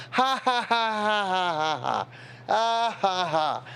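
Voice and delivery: high mock laugh, falling in pitch; put-on "ha ha" party laugh; mock group laughter. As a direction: imitate a roomful of laughing relatives, starting high. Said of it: "they're all".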